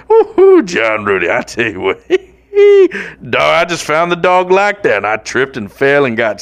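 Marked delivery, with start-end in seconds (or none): 0.1-0.6 high yelping woo-hoo; excited whoop. Real, not acted. Podcaster whooping at the dog joke, high whoop. 2.5-3.2 shrieking laugh, loud and high; high shriek of laughter. Real, not acted. Host cracking up at his own joke, shrieking laugh.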